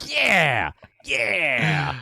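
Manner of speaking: high, drawn-out cheer of approval; amped-up cheer. Retold or imitated. Viewer cheering as the phone gets chucked, amped up. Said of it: "that got me amped up".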